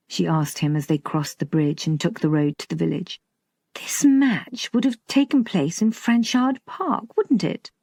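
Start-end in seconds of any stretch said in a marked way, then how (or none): 3.7-7.7 higher-pitched, probing query; a hint of an insistent daughter; lightly voiced questioning daughter. A narrator's reading. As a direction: press for confirmation in a raised pitch.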